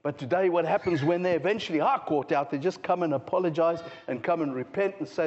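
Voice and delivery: normal talking voice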